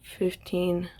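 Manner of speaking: drowsy, half-asleep counting; nodding off. Real, not acted. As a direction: mumble the numbers sleepily. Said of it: "I'm half asleep".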